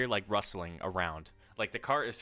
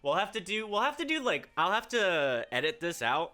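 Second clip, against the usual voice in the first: excited; 10 semitones higher, 5 dB louder than usual. Real, not acted. Hyped after the session.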